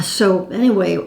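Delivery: normal talking voice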